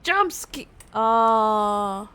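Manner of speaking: ordinary talk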